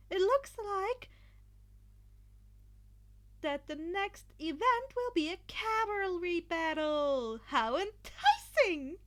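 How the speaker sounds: high, loud and drawn-out, thrilled announcer; excited Midnight. A narrator's reading. Midnight announcing the next event to the crowd, excited and loud. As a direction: savour each word like a thrilled host. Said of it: "Midnight called, squirming in excitement".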